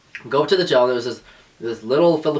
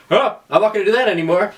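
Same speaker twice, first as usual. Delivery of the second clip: put-on startled kid giving in; 8 semitones higher, 4 dB louder than usual. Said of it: "I was like".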